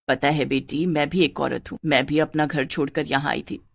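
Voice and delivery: low, soft motherly voice; caring mother. Acted. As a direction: gently ask as a concerned older mother. (ममता भरी माँ की धीमी आवाज़)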